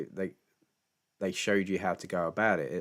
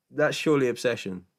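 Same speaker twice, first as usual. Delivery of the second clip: ordinary delivery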